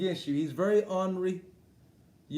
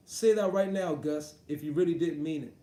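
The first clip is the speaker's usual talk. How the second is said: normal talking voice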